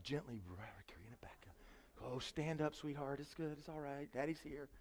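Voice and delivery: hushed, gentle whisper to a little one; soothing a child. Retold or imitated. Father whispering to his daughter on the lawn, soft and tender. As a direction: coax her softly back off the grass.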